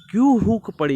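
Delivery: poem quote. Shown as a demonstration. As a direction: call out the poet's question. Quoting poem, raised voice. (ऊँचा कविता पाठ)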